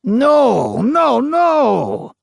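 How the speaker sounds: high, loud shouted no; shouting. A narrator's reading. Ghost shouting denial at the interviewer. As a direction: cry out in protest. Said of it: "shouted the ghost".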